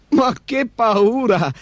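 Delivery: high, drawn-out mock fright; mocking amusement. A narrator's reading. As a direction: stretch it out teasingly, pretending to be scared. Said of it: "rise Gosto". (finta paura, acuta e canzonatoria)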